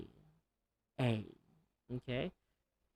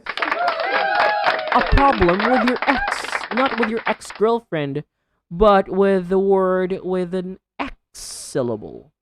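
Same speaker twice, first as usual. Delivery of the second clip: playful announcing; 6 semitones higher, 6 dB louder than usual. Real, not acted. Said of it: "playful announcement".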